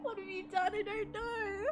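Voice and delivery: high, tearful squeak; squeaky, upset wail; distressed whimper. Real, not acted. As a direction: whimper it high, close to crying. Distressed reaction to the game.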